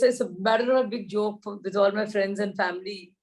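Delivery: ordinary delivery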